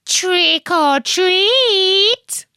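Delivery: high, slow, rising sing-song call; second little witch. Acted. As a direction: stretch it out as the other little witch. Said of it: "And her friend said".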